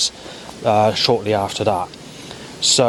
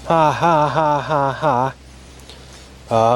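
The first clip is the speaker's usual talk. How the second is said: mock seagull cackle; 4 semitones higher, much slower than usual. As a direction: imitate a seagull laughing at you. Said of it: "A seagull goes".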